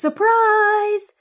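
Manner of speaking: high, loud party yell; surprise shout. A narrator's reading. Guests springing out at Patrice, loud high shout.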